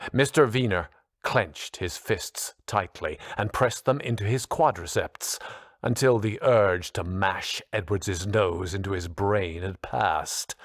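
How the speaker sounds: ordinary delivery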